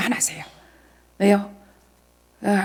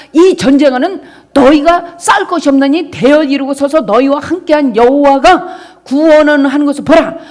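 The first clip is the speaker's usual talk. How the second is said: full-force preaching; 4 semitones higher, 9 dB louder than usual. Acted. Pastor crying God's command to the congregation, loud shouted preaching. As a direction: call the verse out like a battle cry.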